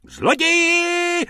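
piercing high shriek. Acted. Tobacconist woman shrieks at Bobek, very high. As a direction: scream thief across the street. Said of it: "rozječela se paní".

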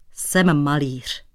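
ordinary delivery, nothing marked

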